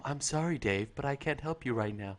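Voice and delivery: low, quiet, eerily calm computer; deadpan machine refusing the astronaut; HAL 9000 voice. Retold or imitated. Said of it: "this Hal?".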